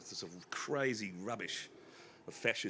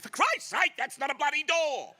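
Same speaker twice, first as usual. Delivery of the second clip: high, loud furious outburst; exasperated shout; 9 dB louder than usual. Retold or imitated. Dad yells at customers knocking on the fake door, loud high outburst. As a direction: blow up like a hot-tempered dad. Father explodes at knocking people.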